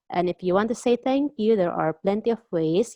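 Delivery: normal talking voice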